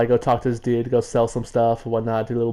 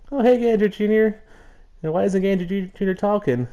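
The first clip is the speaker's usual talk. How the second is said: higher, cheery character voice; Stump's voice; 8 semitones higher than usual. Acted. Stump greeting Gander Junior, higher cheery voice. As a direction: greet him brightly in a raised voice. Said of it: "is like".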